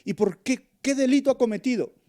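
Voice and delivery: high, loud, fast challenge; pressing question. Retold or imitated. Preacher as reporter confronting the mob, loud challenge. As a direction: confront the crowd, demanding an answer. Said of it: "le preguntaría".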